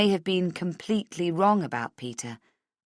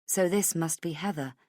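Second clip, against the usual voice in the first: plain reading voice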